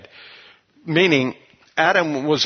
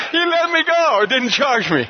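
usual speaking voice

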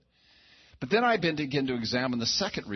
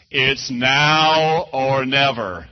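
slow, booming and much louder; God's voice of many waters, drawn out; 4 semitones higher, 12 dB louder, much slower than usual. Retold or imitated. Preacher voicing God's voice inside him, slow booming. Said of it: "And the voice said this".

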